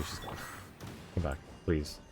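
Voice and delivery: ordinary delivery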